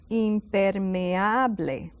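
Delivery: impermeable, very slow, syllable by syllable; slow model word. Shown as a demonstration. Teacher slowly modelling a long word: impermeable. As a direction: drag out impermeable carefully. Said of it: "very long word".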